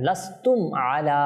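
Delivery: slow, distinct লাস্তুম আলা without lingering on the m; clear m, no ghunnah. Shown as a demonstration. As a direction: articulate লাস্তুম cleanly before আলা. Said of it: "একদম স্পষ্টভাবে উচ্চারণ করতে হবে". (লাস্তুম আলা একদম স্পষ্ট করে)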